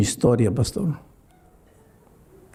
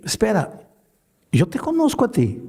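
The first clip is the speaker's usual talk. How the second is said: higher, startled realization; surprised, pitched-up recognition; sudden recognition; 8 semitones higher than usual. Retold or imitated. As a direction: catch yourself as you recognize him. Pastor recognizing the man.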